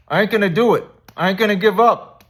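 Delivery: high, loud mock battle cry; mirror pep talk shout. Psyching yourself up in the mirror, loud hammed-up shout. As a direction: yell it at the mirror like a pumped-up fighter. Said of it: "Tell yourself every day in the mirror".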